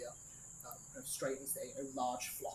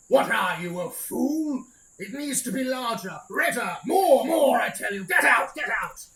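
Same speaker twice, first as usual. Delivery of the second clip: roaring, much higher and far louder; booming furious shout; 9 semitones higher, 17 dB louder, slower than usual. Acted. Unseen lord bellowing at his servant, furious booming shout. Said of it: "this big booming voice coming from inside".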